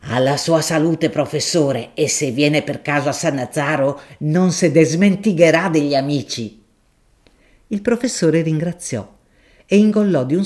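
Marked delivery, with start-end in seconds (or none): 0.1-6.6 a deferential, earthy shopkeeper making his offer; grocer Gaudenzio. Acted. Gaudenzio toasts.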